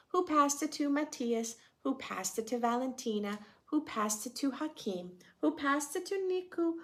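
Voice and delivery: put-on gentle read-aloud for children; a teacher reading a picture book aloud; storybook narration. A narrator's reading.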